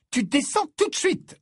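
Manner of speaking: high, loud motherly order; commanding mother. Acted. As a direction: bark the order like a stern mum. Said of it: "ordonna Mrs Wesley".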